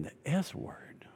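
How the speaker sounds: breathless whisper; whispered disbelief. Retold or imitated. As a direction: whisper it in shock. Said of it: "I'm thinking".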